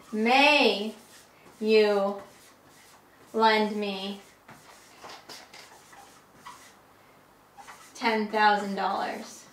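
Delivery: slow, word by word with long pauses; very formal request. Shown as a demonstration. Asking the boss for money, very slow and formal. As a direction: spell out each word with great formality. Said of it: "may is the highest formality".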